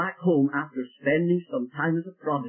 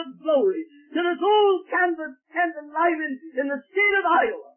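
preaching at full force; 9 semitones higher than usual. Real, not acted. Preacher marvelling at God watching the tent, fervent cry. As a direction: let the wonder break out of you.

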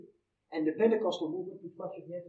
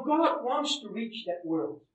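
ordinary talk

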